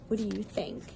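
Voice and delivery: ordinary delivery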